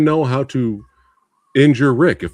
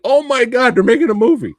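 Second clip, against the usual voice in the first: high, loud burst of fan excitement; excited outburst; 11 semitones higher, 6 dB louder than usual. Retold or imitated. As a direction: relive the thrill of hearing big news. Recalls reacting to movie announcement.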